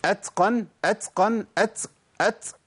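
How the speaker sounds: أتقن and أت with ت turned into ts; sibilant ta, wrong. Shown as a demonstration. Teacher demonstrating the forbidden error, أتقن with hissy ts. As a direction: let an s hiss after each ت in أتقن. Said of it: "ما أبدلش التاء بسين".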